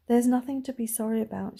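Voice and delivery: ordinary delivery, nothing marked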